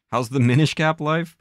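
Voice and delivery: excited. Acted. Reading a chat question loudly.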